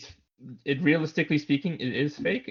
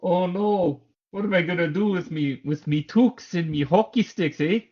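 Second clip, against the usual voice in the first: higher, louder hammed-up Canadian accent with a drawn-out eh; exaggerated Canadian accent; 6 semitones higher, 5 dB louder than usual. Joking co-host voices a fretting Canadian, hammed-up Canadian accent. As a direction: play the worried Canadian hockey fan, Canadian accent. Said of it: "There's the accent".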